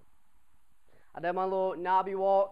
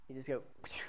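rocket whoosh; 5 semitones lower, 10 dB quieter, faster than usual. Retold or imitated. Mario Kart rocket sound.

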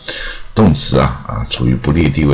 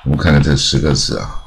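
normal talking voice

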